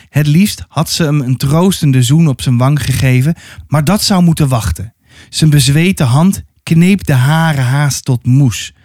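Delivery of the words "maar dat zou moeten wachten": usual speaking voice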